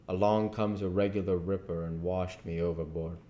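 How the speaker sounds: usual speaking voice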